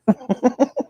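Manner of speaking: high-pitched giggle; laugh. Real, not acted. Host jokes about her own hillbilly sound, high laugh.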